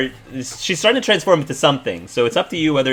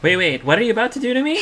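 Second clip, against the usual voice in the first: high, fast and panicked; frightened high voice; worried; 10 semitones higher, faster than usual. Acted. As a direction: blurt it out in alarm. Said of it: "Energy says. She seems very, very worried".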